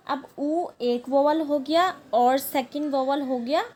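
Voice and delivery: ordinary talk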